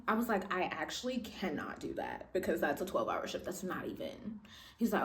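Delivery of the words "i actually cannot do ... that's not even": much softer, low refusal; low flat refusal. Retold or imitated. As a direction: turn it down quietly and low. Quoting her own refusal.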